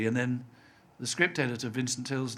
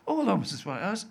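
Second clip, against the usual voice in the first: higher, polite retold hello; polite greeting; 8 semitones higher, faster than usual. Returning son greeting parents' neighbour, politely higher. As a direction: answer the neighbour with a lifted, courteous greeting. Acting own reply to the neighbour.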